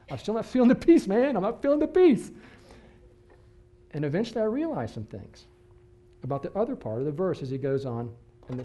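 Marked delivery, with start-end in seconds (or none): 0.1-2.3 much higher, louder pleading to God; desperate prayer. Retold or imitated. Retold complaint to God at night, high loud pleading. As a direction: beg God, voice climbing.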